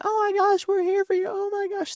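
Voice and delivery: very high, gushing mock sympathy; mocking gushing friends. Mocking everyone comforting the girl online. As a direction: squeal it like fake-caring friends. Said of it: "everyone's like".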